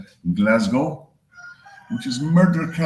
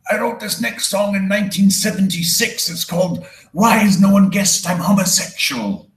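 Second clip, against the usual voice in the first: [name]; 8 semitones higher than usual. Retold or imitated. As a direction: belt it like a stadium rock star. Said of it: "[name] knew how to introduce a show".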